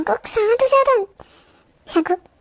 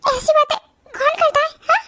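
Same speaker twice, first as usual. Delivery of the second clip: crying; 9 semitones higher than usual. Acted. Upset daughter fears her surgery.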